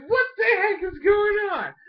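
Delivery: high, loud, disbelieving yell; shocked outcry. Retold or imitated. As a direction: cry out in total shock. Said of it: "I'm like".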